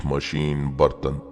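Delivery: ordinary delivery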